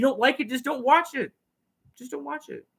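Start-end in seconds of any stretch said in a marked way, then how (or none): 2.0-2.7 much softer, almost under the breath; soft repeat. Shown as a demonstration. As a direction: drop the level right down and say it again.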